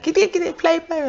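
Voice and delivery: put-on high little-boy voice, excited about a kitty; child's voice. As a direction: mimic a six-year-old tugging toward a cat. Child son voiced in story.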